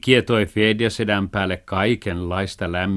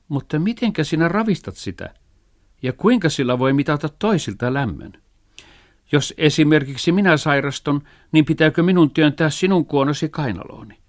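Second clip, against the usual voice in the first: puzzled father; 6 semitones higher than usual. A narrator's reading. Father questions the dog.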